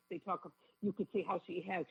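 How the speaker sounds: normal talking voice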